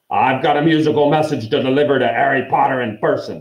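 insistent messenger; grim dwarf. A narrator's reading. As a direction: announce it brusquely, like a pushy messenger. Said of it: "twanging his harp in a threatening sort of way".

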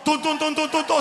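rhythmic tun tun tun stomps; footsteps sound effect. Retold or imitated. Everyone rushing off after the queen, mock footsteps. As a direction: mimic people marching off.

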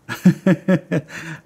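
a short bright real laugh; breezy self-mocking chuckle; light laugh. Real, not acted. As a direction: laugh it off, amused at yourself. Laughs about hazy childhood memory.

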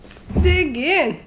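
eager. Real, not acted. Eager start of ASMR eating.

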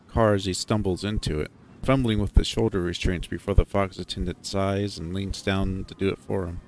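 ordinary delivery, nothing marked

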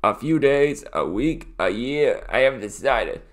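easygoing Australian listing; Australian accent. Acted. Cousin answers how long he is staying, breezy Australian accent. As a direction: toss out options in breezy Australian. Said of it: "grinned Mister Clumsy".

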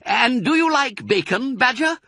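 high, loud, teasing offer; gleeful fox. Acted. Fox offers Badger bacon, gleefully. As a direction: tempt a friend with a treat.

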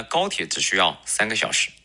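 usual speaking voice

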